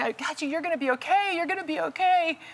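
high fast reassuring call; urgent reassurance. Retold or imitated. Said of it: "I kept saying".

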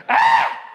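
a sharp airy scream; whispered shriek. Shown as a demonstration. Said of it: "I wanted to illustrate".

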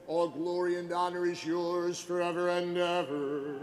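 ordinary delivery

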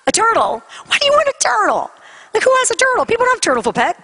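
high-pitched incredulous questioning; astonished mom, pitch jumping up. Retold or imitated. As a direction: balk at an absurd request. Mother reacts to child's request.

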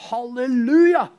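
loud jubilant shout of halleluja; joyful halleluja. Acted. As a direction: cry it out in triumph.

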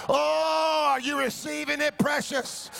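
insistent, high-energy calls to the congregation; rousing preaching. Real, not acted. Pastor asking whether the church is receiving it, rousing preaching. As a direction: press the crowd for a response.

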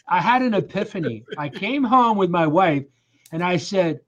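normal talking voice

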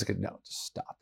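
whispered aside, cutting it off. Real, not acted. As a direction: hush it out as a quick stop. Cuts off a wrong idea.

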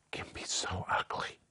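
hushed, secretive whisper for the punchline; mock-scandalized whisper; conspiratorial whisper. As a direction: lean in and whisper the joke. Joking about sinful babies.

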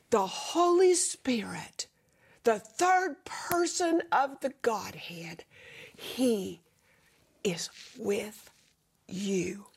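hushed intimate. Acted. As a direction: hush it like a secret of presence. Intimate reminder Spirit is near.

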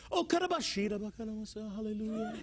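rapid, chanted mock tongues; quick monotone burst of praise. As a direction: imitate relieved praying in tongues. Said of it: "tongue of praise".

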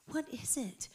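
acted whisper of a question; hushed reply. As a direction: whisper it, taken aback. Teller's hushed reply to frantic group.

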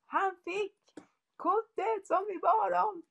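hög, gråtfärdig röst som ropar mamma; härma sonens jubel, högt och andfått; upprymt barnrop. Retold or imitated. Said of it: "kom ju med, fram till mig direkt och sa att". (sonen Noah ropar till mamma, gråtfärdigt upprymd)

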